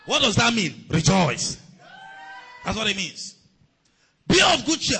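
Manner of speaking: loud, high, driving sermon shout; full-force preaching. Acted. Preacher rousing the congregation against persecution, shouting. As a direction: preach it with rising fire.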